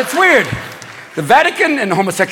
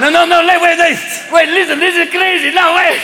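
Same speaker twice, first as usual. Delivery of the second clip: high, excited mock pitch; hammed-up giddy insistence, high-pitched; giddy stoned cardinal; 8 semitones higher than usual. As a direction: burst in with a crazy idea. Stoned cardinals pitching a crazy idea.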